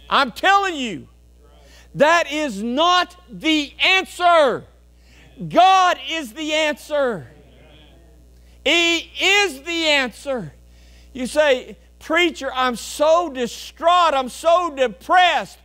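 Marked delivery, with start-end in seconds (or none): 1.9-10.5 high, slow, weighty declaration; slow, ringing pulpit proclamation; emphatic preaching. Real, not acted. 12.0-15.6 distressed congregant. Acted.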